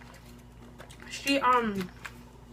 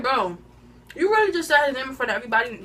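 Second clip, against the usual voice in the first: loud, fast, high-pitched protest; worked-up retold outrage; indignant comeback; 5 semitones higher, 7 dB louder, faster than usual. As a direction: fire back in a raised, rushed voice.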